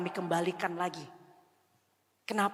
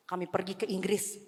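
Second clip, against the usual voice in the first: normal talking voice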